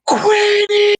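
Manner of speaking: high calling shout. Acted. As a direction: call her name across a crowd. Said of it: "top of her lungs".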